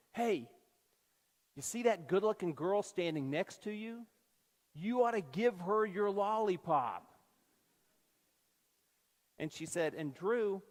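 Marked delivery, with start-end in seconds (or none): 0.1-7.0 hammed-up stage whisper, higher; a playful loud aside meant to be overheard; mock loud whisper. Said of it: "said in a really loud whisper".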